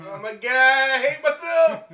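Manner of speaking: loud. Acted. Joking self-mockery.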